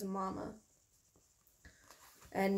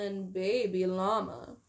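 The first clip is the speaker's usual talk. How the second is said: plain reading voice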